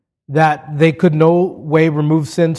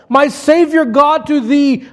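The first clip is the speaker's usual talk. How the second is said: much higher, ringing hymn quotation; exultant declamation; 11 semitones higher, 4 dB louder than usual. Acted. Preacher declaiming a hymn to the congregation, exultant. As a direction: proclaim it at the height of the sermon.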